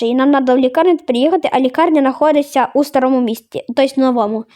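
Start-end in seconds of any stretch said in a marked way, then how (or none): none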